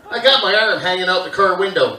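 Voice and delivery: normal talking voice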